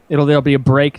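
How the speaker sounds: ordinary talk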